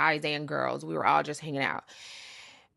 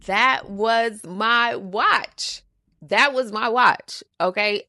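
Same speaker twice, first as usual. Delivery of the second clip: proud boast; 5 semitones higher, 7 dB louder, slower than usual. Real, not acted. Proud emphatic boast about Rolex.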